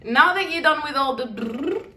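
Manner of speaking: ordinary delivery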